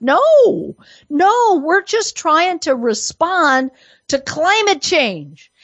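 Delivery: high-pitched mock-innocent protest; sarcastic denial. Commentator mimicking the left's climate excuse, mock-innocent.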